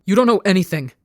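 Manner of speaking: loud burst of panic; panicked shriek. A narrator's reading. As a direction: cut in with a panicky cry. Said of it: "Ranbu shrieks in panic".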